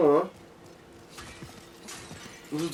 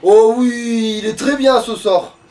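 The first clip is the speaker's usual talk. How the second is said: delighted; 4 semitones higher, 5 dB louder, much slower than usual. Real, not acted. Gamer delighted by new spell.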